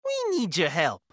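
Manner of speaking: high, loud eerie character voice. A narrator's reading. Two visitors asking Alex for help, eerily in harmony. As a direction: lift it into an eerie appeal. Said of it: "their voices harmoniously eerie".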